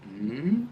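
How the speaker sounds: mm sliding up in pitch; rising mm demo; rising hum. Shown as a demonstration. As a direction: glide the hum upward. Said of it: "a little rising".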